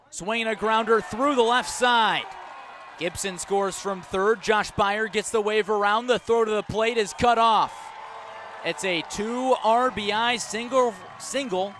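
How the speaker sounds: loud, rising play-by-play over the crowd; excited home run call. Real, not acted. Announcer calling the go-ahead hit, shouting with excitement. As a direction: let it rip as the runs come home.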